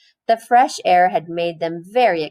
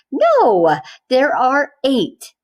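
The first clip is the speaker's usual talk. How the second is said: exasperated teacher; 6 semitones higher, 5 dB louder, slower than usual. A narrator's reading.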